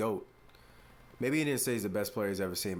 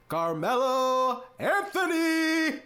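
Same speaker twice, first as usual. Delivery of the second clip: "Carmelo Anthony!" stretched out slowly, much higher and louder, "Anthony" held long; hype announcer call; 13 semitones higher, 7 dB louder, much slower than usual. Shown as a demonstration. Host hyping his hero Melo's name, stretched announcer shout. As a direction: belt the name like an arena announcer introducing a star. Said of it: "I gotta say it like that almost every time".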